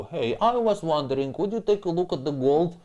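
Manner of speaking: imitating a nagging viewer asking a favour; pestering asker. Mimicking people who ask him to check their gold, mock pestering tone. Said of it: "no such opportunity to".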